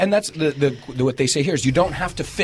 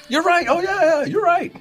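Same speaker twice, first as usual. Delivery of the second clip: high, bright, hammed-up placating yes; placating agreement; 3 dB louder than usual. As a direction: agree just to end the argument. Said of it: "I'm like".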